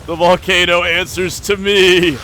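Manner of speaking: loud, slow, high villain proclamation; slow theatrical villain menace. Acted. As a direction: proclaim it like a mad tyrant. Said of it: "see me turn this evil".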